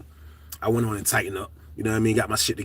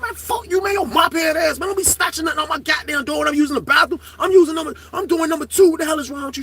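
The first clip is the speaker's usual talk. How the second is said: retold heated yelling; 11 semitones higher than usual. Inmate yelling at the officer about the bathroom. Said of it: "But I am pissed".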